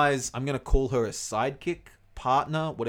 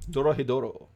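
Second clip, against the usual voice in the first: title said in a hammed-up Japanese accent; a put-on Japanese accent on "Doro Hey Doro"; mock Japanese accent; 4 dB louder than usual. Host repeats the anime title in a mock Japanese accent. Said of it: "Shouldn't do that in an accent".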